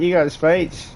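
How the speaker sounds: high mocking shout of got; heckling challenge. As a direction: egg him on like a rowdy guest at a wedding. Heckler vs best man repeating.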